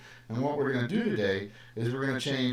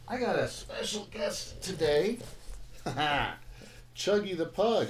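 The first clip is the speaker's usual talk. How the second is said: higher, cooing voice for the dog; pet talk; 6 semitones higher than usual. Real, not acted. Teacher cooing over his pug on the livestream, higher pet-talk. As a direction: coo like you're showing off a puppy.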